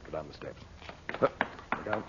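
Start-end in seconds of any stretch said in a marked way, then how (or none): 0.0-0.6 quiet hushed order. Acted. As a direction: keep it low, sneaking in. Sneaking into a guarded tomb.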